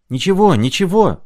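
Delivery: high, loud eager cry; high loud reassurance; shout. A narrator's reading. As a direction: shout it out, brushing it off. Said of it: "закричал Шумков".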